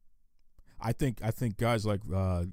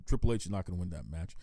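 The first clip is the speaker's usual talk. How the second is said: ordinary talk, nothing marked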